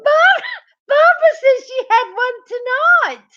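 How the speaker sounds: high, loud squeal through real laughter; high-pitched, laughing shout; laughing shriek. Real, not acted. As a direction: read it out while helplessly cracking up. Reading chat while cracking up.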